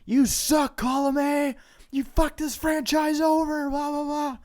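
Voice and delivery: high-pitched mock heckler yell; heckling crowd yell. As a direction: jeer it like a rowdy fan. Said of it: "nonstop shit-talking from everybody in the crowd".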